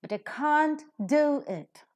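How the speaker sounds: slow, with can't and do punched high and loud; slow stressed demo. Shown as a demonstration. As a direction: pronounce it slowly, leaning on can't and do. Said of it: "So pronounce slowly and with an accent".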